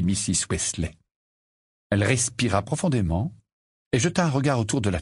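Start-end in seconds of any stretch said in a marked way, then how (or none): none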